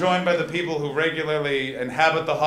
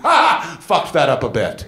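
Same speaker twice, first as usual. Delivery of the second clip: rueful burst; 9 dB louder, slower than usual. Retold or imitated. Comic reliving his awkward exit, loud rueful laugh. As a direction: laugh it off loudly. Inner thought after social situation.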